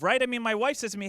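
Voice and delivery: usual speaking voice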